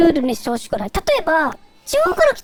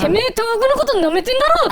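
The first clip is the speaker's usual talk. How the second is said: mock angry Tohoku local; 4 semitones higher than usual. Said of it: "って言って".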